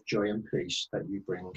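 ordinary talk

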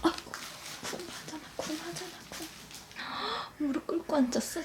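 usual speaking voice

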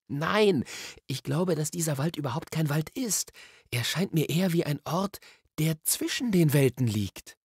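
Diggory, musing. A narrator's reading. Diggory tells Polly about a wood between worlds, musing. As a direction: unfold the theory with growing weight. Diggory explains his theory.

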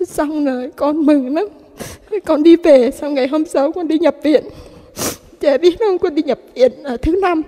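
tearful, high and loud, voice breaking with sobs; weeping through the words as the relief spills over; crying. Real, not acted. Mother breaks down recounting healing.

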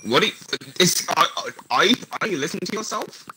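incredulous. Real, not acted. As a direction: react in disbelief. Incredulous reaction to friend.

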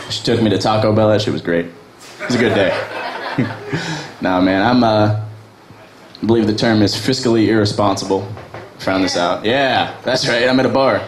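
normal talking voice

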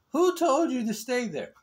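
mock scolding. Real, not acted. Scolding the pet playfully.

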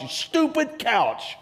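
high, slow mock scolding of a couch; mock anger at couch. Preacher mocking anger at a couch.

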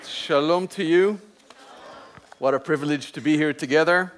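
ordinary talk

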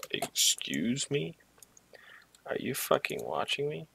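high, slow, startled disbelief. Real, not acted. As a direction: caught off guard, indignant. Startled by someone watching in game.

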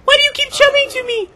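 squeaky high falsetto complaint; mock whining. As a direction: whine it in a put-on, very high voice. Mock whining at other players.

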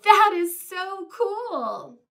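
slow, loud and high with delight; thrilled. Acted. Gerald telling Piggy, thrilled and slow. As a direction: savour every word of the wonder.

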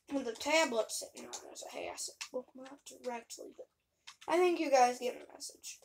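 normal talking voice

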